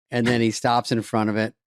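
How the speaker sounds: normal talking voice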